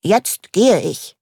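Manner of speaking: slow, firm announcement; stern. Acted. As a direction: tell the chandelier off sternly. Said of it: "teilte Mumin dem Leuchter streng mit".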